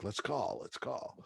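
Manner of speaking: whispered urging in class; sneaky classroom whisper. Retold or imitated. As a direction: hiss it so the teacher won't hear. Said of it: "I'm like, in class".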